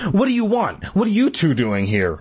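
mildly demanding question. A narrator's reading. Regina to the two boys, mildly demanding. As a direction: start high, then drop on the follow-up question. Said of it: "Regina demanded".

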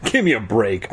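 ordinary delivery, nothing marked

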